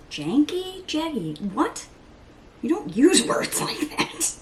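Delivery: high, incredulous questioning; mock self-scolding. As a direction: pick at the word in high-pitched disbelief. Mock-questions her own word choice.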